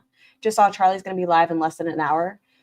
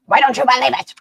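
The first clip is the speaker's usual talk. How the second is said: loud mock imitation, pitch pushed up; mocking growl; 7 semitones higher, 8 dB louder, faster than usual. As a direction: parrot her line back with a loud growl. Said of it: "I wanna hear you growl at me".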